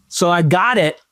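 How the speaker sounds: ordinary talk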